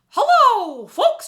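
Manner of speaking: very high, loud cartoon mouse squeak; Mickey Mouse voice. Shown as a demonstration. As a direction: falsetto cartoon mouse hello. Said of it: "that's a great exercise to do".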